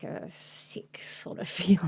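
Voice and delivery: soft uneasy laugh through words; nervous laugh. Real, not acted. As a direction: laugh it off quietly, unsettled. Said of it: "I don't know why I'm laughing at that".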